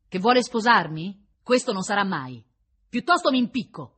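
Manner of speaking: loud, high, indignant rejection; vehement refusal. A narrator's reading. Prisoner rejecting the prince's marriage offer, vehement. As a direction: refuse him with rising force.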